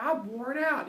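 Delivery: high, weary Maya voice; a high, tired Maya voice. Retold or imitated.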